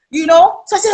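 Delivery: ordinary delivery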